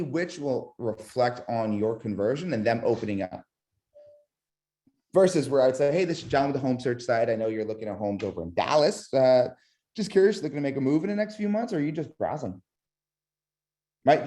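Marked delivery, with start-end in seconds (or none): 5.9-12.6 fast, rushed sales pitch. Shown as a demonstration. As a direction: rattle it off too fast, salesy. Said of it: "not the way we want it to sound".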